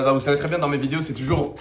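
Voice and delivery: usual speaking voice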